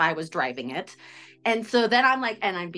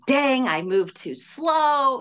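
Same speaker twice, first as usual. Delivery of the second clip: drawn-out, louder, higher lament; mock self-reproach; 5 semitones higher, 4 dB louder, much slower than usual. Woman retells blaming herself for losing the car, exaggerated regret. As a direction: kick yourself aloud, stretching the words slowly.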